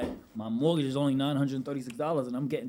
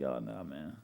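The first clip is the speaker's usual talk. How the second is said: disappointed; 12 semitones lower, 9 dB quieter, slower than usual. Retold or imitated. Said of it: "I was like".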